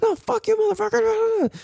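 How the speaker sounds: high-pitched mock cursing; hammed-up high retort tailing into babble; imitating an angry old man. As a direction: fire back like an irate old guy. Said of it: "so angry, though".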